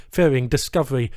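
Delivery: ordinary delivery, nothing marked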